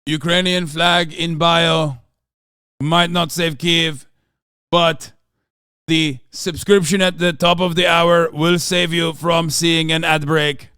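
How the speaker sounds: slow, loud put-on accent; mock Zelensky voice. Shown as a demonstration. Streamer selling subs in the mock Zelensky accent. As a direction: hammer it out slowly in the mock accent. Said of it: "very raspy voice".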